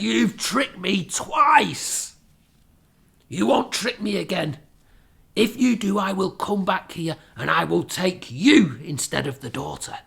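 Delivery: loud, furious threat; angry man. Acted. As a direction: bellow the threat at her. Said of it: "He said".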